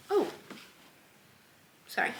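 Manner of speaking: ordinary talk, nothing marked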